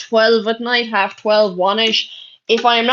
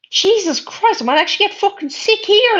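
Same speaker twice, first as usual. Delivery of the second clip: high, rushed revulsion; disgusted exclamation; 9 semitones higher than usual. Real, not acted. Streamer sickened by a viewer's proposition, disgusted. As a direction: voice up high, appalled.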